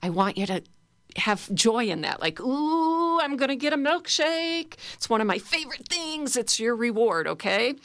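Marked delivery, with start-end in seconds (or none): 2.4-6.4 put-on high, gleeful child voice; excited five-year-old. Shown as a demonstration. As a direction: squeal it like a kid promised a milkshake. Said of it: "embrace your inner five-year-old".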